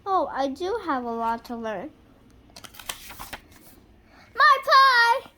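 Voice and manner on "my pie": high, loud cry of alarm; alarmed high exclamation; exclaiming. A narrator's reading. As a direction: shriek it like the pie is burning. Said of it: "exclaimed Amelia Bedelia".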